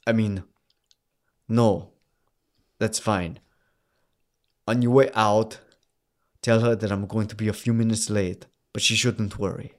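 put-on halting, restrained Austrian accent. Husband leaving a message for his wife, halting Austrian accent. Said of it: "I have to do this motherfucking accent".

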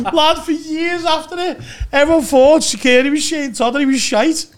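high, loud laughing talk; still laughing. Real, not acted. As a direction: tell it through the laugh. Telling through laughter.

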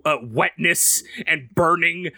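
ordinary talk

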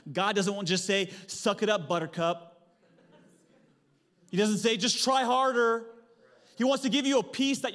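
loud, high preacher's build; rising sermon shout; full-force preaching. Acted. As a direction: drive it home from the pulpit.

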